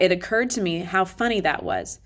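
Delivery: ordinary delivery, nothing marked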